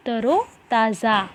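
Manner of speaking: तरोताज़ा slow and drawn out; model pronunciation. Shown as a demonstration. Teacher has pupils repeat after her, slow model pronunciation. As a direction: say तरोताज़ा slowly, part by part: तरो, ताज़ा. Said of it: "जैसे मैं बोलती जाऊँगी उसी तरह से".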